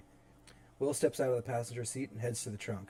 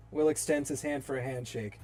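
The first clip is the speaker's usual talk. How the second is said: normal talking voice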